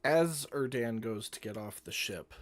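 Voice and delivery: ordinary delivery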